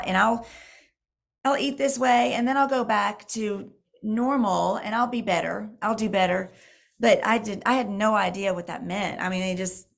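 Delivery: normal talking voice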